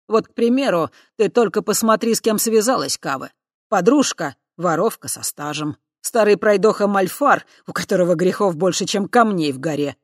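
loud sneering ridicule; mock each friend with scorn. Spirit mocks her friends loudly.

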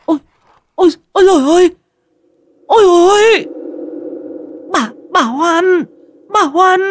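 high, loud, sobbing cries of horror; terrified shriek. Acted. Wife sees the dead woman's ghost, shrieking high and loud in terror. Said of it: "kinh hoàng rú lên một tiếng".

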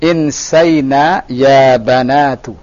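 very slow, drawn-out phrase for repeating; model reading. Shown as a demonstration. Teacher modelling the feminine plural for a student, slow model reading. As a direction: stretch each vowel, fall at the end. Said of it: "kita baca sama-sama".

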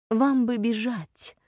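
slow, weary and wry; a worn-out half-smile in the voice; tired, with a smile. A narrator's reading. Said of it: "устало улыбнулся прорицатель".

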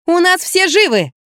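high, drawn-out exclamation; barked reply. A narrator's reading. Woman telling caller everyone is alive, barking. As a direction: bark it out slowly on a high pitch. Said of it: "села на диван и гаркнула".

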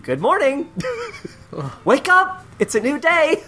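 high chirpy little-creature voice; bug's cheerful greeting. Pretend. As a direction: greet like a cheery tiny bug. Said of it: "he would have said".

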